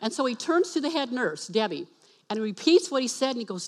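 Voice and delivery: usual speaking voice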